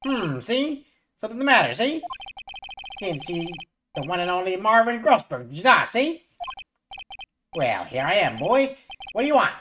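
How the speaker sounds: put-on high, loud old-timey gangster lawyer with see; Grossberg voice. Gamer voicing the old lawyer meeting the young attorney, high and loud. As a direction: hammer each see up high like a wiseguy.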